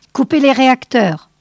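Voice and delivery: urgent command. A narrator's reading. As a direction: order the engines cut. Order to cut engines.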